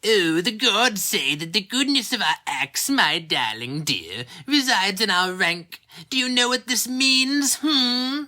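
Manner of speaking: high, put-on old grandmother voice, coaxing; a doting, tipsy grandmother lecturing her grandchild; grandmother's voice. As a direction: grandson recalls grandmother telling him he is beyond sin, high and coaxing. Grandmother voiced in man's story.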